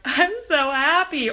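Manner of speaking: high, loud, slow overwhelmed joy; stretched-out teary joy; happy tears. Retold or imitated. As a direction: near tears from sheer happiness. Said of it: "sometimes I literally start crying".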